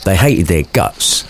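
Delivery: ordinary talk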